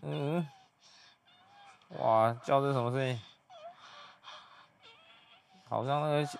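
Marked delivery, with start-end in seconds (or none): 0.0-2.0 imitated high moan. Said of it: "这女的叫很大声".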